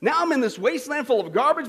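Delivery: ordinary talk, nothing marked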